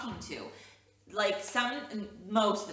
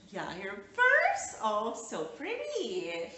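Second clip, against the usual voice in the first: much higher, slow and cooing; 4 semitones higher, 4 dB louder, much slower than usual. Real, not acted. Mother praising toddler's purse.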